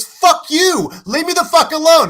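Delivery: high, loud, hammed-up yell; angry Lebowski quote. Relaying a movie message to Walter, angry shout. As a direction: burst out with the message. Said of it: "Yes, there is a message here".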